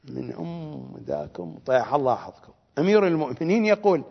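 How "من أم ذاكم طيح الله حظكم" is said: a lower, much softer scornful mutter; contemptuous aside. Real, not acted. Preacher cursing the Najaf clerics, low scornful mutter. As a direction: spit the insult under your breath.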